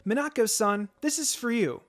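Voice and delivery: put-on high, loud gushing boys offering gifts; eager admirers. A narrator's reading. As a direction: gush over her, pushing the gift. Admirers pressing gifts on her.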